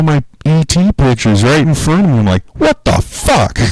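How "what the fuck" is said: very high, slow, stretched-out disbelief; stunned. Retold or imitated. As his photos vanish, a very high drawn-out cry of disbelief. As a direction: let it crack up high and slow. Watching his files get deleted.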